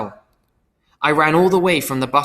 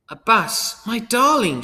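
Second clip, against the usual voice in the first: surprised; 9 semitones higher, slower than usual. Retold or imitated. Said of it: "all surprised".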